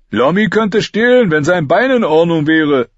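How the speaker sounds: Heiße Pastete's defence. A narrator's reading. Heiße Pastete defends Lommi, earnest. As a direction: stick up for a friend.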